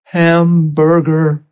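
drawn-out, very slow hungry moan of one word. Retold or imitated. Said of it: "I wasn't like".

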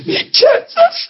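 a touch of crying, voice pushed up high; imitating tearful squeak. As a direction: echo her choked-up tearful words. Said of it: "get squeaky voiced and so sweet and tears pouring".

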